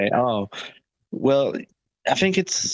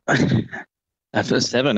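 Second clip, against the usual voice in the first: ordinary talk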